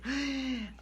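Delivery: high, panicky intake of breath; activated child gasp. Acted. Coach acting the child getting activated, panicky gasp. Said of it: "the child gets activated".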